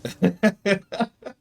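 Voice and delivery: high rising laugh; real laughter. Real, not acted. Player amused at his own joke, high rising laugh. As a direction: let out a high run of giggles. Joking about nonexistent mother.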